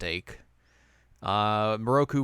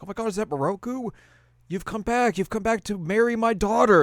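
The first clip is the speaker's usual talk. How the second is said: much higher, gushing, louder to the end; mock overjoyed villager; excited villager; 11 semitones higher, 5 dB louder than usual. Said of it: "one of the villagers is like".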